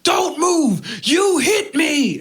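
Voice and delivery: high shouted order, almost crying; cop yelling. Retold or imitated. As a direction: bark it out high and drawn out.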